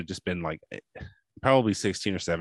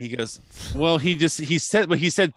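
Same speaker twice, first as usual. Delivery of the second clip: usual speaking voice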